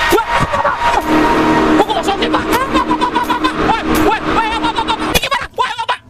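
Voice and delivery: high frantic cries for help; panicked yelling. Real, not acted. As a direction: scream as the lights die. Panicking as lights go out.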